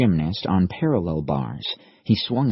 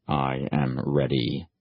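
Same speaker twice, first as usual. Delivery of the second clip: low steady resolve; 6 semitones lower, 4 dB quieter, slower than usual. Acted. Imagined AI unit message.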